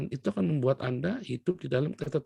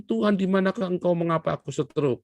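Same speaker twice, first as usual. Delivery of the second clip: raised, higher plea to God; loud, higher-pitched complaint: where are you, God; complaining cry; 5 semitones higher, 4 dB louder than usual. Acted. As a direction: cry out like a wronged servant. Sick pastor complains to God.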